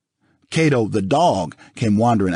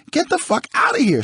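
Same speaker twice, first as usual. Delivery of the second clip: screaming. Acted. Nicole screams at O J.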